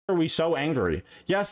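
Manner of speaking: ordinary talk, nothing marked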